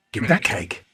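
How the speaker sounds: ordinary talk, nothing marked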